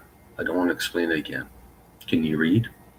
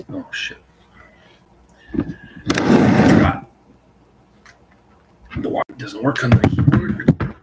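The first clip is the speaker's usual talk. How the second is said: frustrated; 6 semitones higher, 8 dB louder, much slower than usual. Real, not acted. Keyboard breaks mid-recording.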